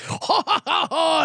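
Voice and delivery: high mock meathead guffaw; ha-ha it up like a jacked-up bro; meathead laugh. Mocking the meathead humor with a dumb laugh. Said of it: "jacked up and, like".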